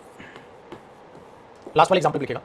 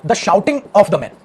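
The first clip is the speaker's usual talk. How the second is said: slow dictation; much slower than usual. Shown as a demonstration. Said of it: "dictating the sentence".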